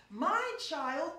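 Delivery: slow, mock-proud parent boast. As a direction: savour each word like a bragging post. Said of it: "What is recorded is".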